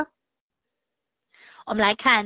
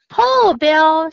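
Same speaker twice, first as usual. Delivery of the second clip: urging call; 4 semitones higher, 7 dB louder than usual. Acted. Jill urging dog uphill.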